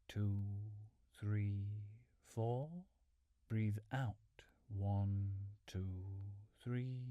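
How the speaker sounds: measured, drawn-out counting to pace breathing; steady meditation count with long, even gaps; slow breath counting. Real, not acted. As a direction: count out the breaths, leaving a pause after each number.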